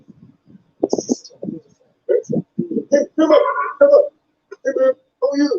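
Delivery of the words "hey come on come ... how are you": lightly voiced excited fan rushing up; imitated deaf fan. Fan greeting the comedian after the show, imitated slurred voice. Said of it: "he was partially deaf".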